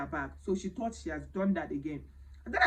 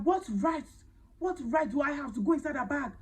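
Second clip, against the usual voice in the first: imitating her shouting, higher and a bit louder; retold screaming; 7 semitones higher, 3 dB louder than usual. The maid screaming at her employer over her bag, raised indignant shouting. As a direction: replay the maid's indignant yelling. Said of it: "This woman started screaming".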